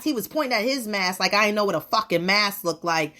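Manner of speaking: ordinary delivery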